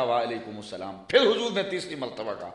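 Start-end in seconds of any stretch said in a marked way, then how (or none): 0.0-1.0 softly, under the breath, a reply meant not to be heard; quiet reply. Retold or imitated. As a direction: answer in a hushed voice so he won't hear. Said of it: "چپکے سے کہا".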